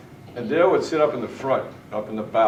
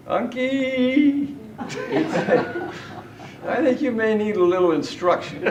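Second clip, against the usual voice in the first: laughing imitation; 11 semitones higher than usual. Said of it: "then Dale would say".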